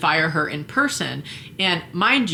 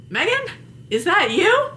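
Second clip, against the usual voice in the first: much higher, innocent mock voice; mock surprised volunteer; 14 semitones higher than usual. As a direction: play dumb in a squeaky voice. Imitating volunteer's reply.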